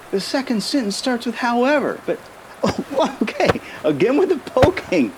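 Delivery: high, giggling protest; laughing while poked. Real, not acted. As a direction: break into giggles mid-sentence.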